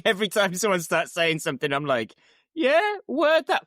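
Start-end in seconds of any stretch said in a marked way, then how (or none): 2.5-3.7 high, drawn-out nervous deflection; slow, squeaky-high mock unease. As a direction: imitate awkwardly playing along, pitched way up. Mimics own nervous reaction to risky talk.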